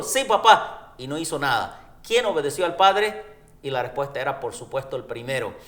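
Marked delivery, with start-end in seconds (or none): 0.0-0.9 eager son. Retold or imitated. Son's quick false yes.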